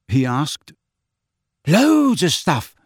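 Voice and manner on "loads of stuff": proud. A narrator's reading. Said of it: "said Neville proudly".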